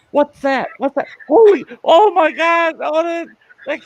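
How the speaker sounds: high, panicky imitation of children; mock child voices in a high-pitched panic; kids freaking out. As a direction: squeal like alarmed kids. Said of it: "the kids are freaking out".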